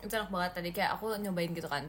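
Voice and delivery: ordinary talk, nothing marked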